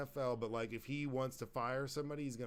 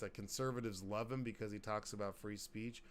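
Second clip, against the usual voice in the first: ordinary delivery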